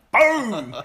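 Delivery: high, loud, punched crash sound; mock impact noise into the rail; loud laughing boom. Head hitting steel railing.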